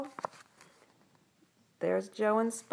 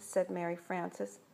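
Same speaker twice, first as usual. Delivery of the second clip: ordinary delivery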